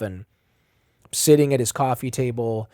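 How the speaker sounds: ordinary talk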